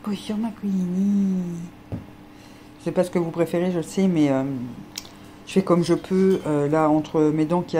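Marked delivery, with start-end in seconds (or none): 0.1-1.4 slow, higher cooing hello. Real, not acted. Owner greeting Queenie again in a higher, slow coo.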